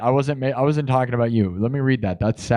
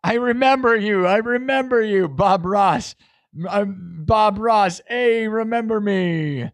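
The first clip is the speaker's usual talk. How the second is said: excited shout-out; 8 semitones higher than usual. Real, not acted. Streamer greeting fan Magic Hub, high excited repeated shout-out. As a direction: gush back at the fan.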